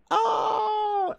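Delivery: very high cry of greeting; light up at a familiar name; delighted shout. Real, not acted. Streamer excitedly greeting an arriving viewer.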